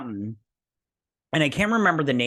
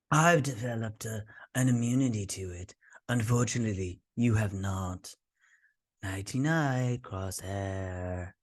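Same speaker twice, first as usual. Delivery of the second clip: creepy villain; 2 semitones lower, 5 dB quieter than usual. Retold or imitated. Doctor taunting the fainting Crosshair, soft and smug. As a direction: gloat softly like a smug villain. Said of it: "this guy comes in".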